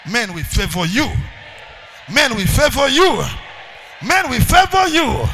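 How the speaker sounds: from loud to a slow, high shout; rising preacher crescendo; building shout. Acted. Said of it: "repeated, building".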